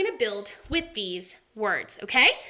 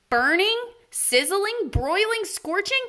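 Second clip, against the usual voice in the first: each word higher than the last; building excitement; 7 semitones higher, 4 dB louder than usual. Shown as a demonstration. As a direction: climb the thermometer with your voice. Reading words as rising heat.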